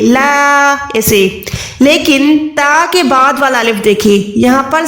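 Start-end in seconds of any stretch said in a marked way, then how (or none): 0.0-1.4 ला stretched long; long alif. Shown as a demonstration. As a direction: draw out the alif in ला. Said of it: "उस अलिफ को लंबा करके खींचना है". (अलिफ को खींचकर ला)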